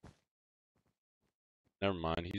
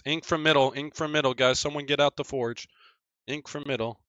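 raised, higher-pitched warning; urgent callout; 8 semitones higher, 7 dB louder than usual. Real, not acted. Gamer warning teammates of an attacker, calling out urgently. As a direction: call out the threat to the team.

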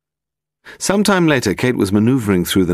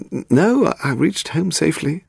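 high-pitched, hesitant reassurance; evasive; 11 semitones higher than usual. A narrator's reading. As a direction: stumble at the start, then assure him evasively. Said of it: "he sounded evasive".

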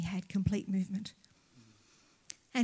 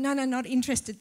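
louder retold refusal, pitched up; repeated brush-off; 4 semitones higher, 6 dB louder than usual. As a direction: re-enact turning the salesman away, loudly. Refusing the salesman a second time.

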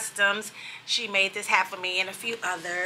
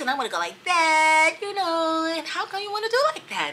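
higher sing-song YouTuber lilt; mocking YouTuber voice; 8 semitones higher than usual. Mother mocking her son's YouTube voice, sing-song and high. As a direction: tease the way vloggers all talk, sing-songy and up high. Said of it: "it always sounds like they're singing a song".

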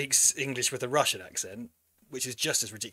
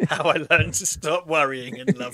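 laughing; 3 semitones higher, 9 dB louder than usual. Real, not acted. Laughing joke title.

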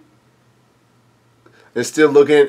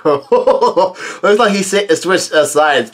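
amused; 5 semitones higher, much slower than usual. Real, not acted. Amused at character switching sides.

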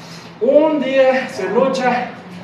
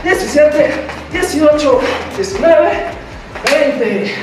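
barking gym coach; 5 semitones higher, slower than usual. Acted. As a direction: bark out each rep to the class. Coach counting reps in class.

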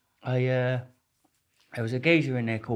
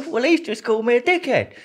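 high, louder whiny mock complaint; Rambo's complaint; 11 semitones higher, 4 dB louder than usual. Rambo whining to the screw about the insult. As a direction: whine it up high like a wounded hardman. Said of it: "He goes".

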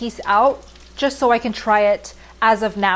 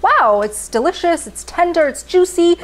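bright, rising delight; excited; 8 semitones higher, 4 dB louder than usual. Retold or imitated. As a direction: gush about the flavor. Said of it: "it's like".

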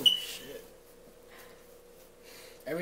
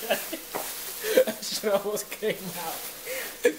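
laughing; 7 semitones higher, 6 dB louder, much slower than usual. Real, not acted. Nearly threw up while drinking.

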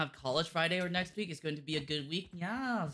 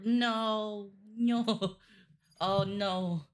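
loud panicked no; 7 semitones higher, 8 dB louder, much slower than usual. Real, not acted. Flashing lights game panic.

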